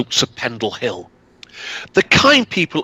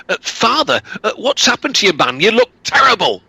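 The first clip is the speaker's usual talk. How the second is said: shocked son; 9 semitones higher than usual. Acted.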